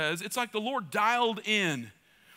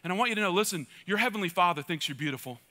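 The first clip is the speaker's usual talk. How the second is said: usual speaking voice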